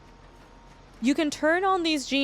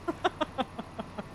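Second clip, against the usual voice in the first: soft, breathless laughter; rueful laugh; 3 semitones higher, 8 dB quieter than usual. Real, not acted. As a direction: laugh at how much it sucks. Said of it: "I'm laughing 'cause it sucks".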